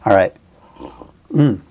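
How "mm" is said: slurp the coffee noisily; slurping hot coffee. Real, not acted. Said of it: "Slurping my coffee".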